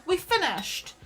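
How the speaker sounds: high, loud cheer; mock celebration. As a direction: pretend triumphant announcement. Mock celebration, then "We haven't.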